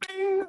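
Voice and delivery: very high-pitched "bing"; ping it like a tiny bell; magic chime. Acted. God granting a wish, high magic chime.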